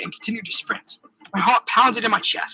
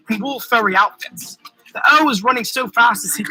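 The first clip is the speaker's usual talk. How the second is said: ordinary delivery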